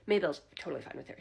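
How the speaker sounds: ordinary talk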